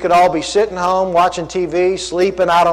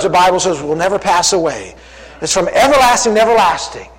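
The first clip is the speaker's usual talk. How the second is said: ordinary delivery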